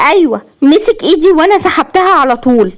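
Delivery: high-pitched heated answer; high, indignant confirmation; agitated wife. A narrator's reading. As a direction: answer back, voice pitched right up.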